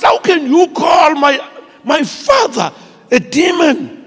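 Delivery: high, loud imitation of tearful outrage; mock crying protest. A crying man defends his father to the pastor, mock-tearful protest. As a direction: cry it out high and loud, mocking the hurt. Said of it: "and he's crying".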